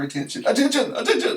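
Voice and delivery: loud, high mock shout of Attention!; comic high yell, Attention! Attention!; hollering Attention. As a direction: holler Attention to scare off a robber. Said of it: "just holler".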